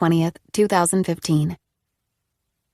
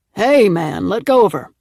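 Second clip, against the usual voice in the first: firm, louder grown man's voice; adult man's voice; 6 dB louder than usual. A narrator's reading. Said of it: "Mister Harper said".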